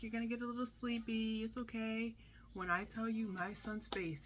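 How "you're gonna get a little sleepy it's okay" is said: put-on higher, gentle soothing to a scared child; soothing mom. Calming son before sedation.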